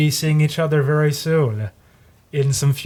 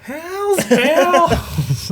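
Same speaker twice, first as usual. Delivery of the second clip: spooky devil voice. Acted. Spooky echo of hell bell.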